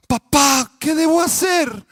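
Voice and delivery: high, loud desperate plea; panicked boy's cry. Retold or imitated. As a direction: beg your father for help. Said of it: "nada más escuchaban los gritos".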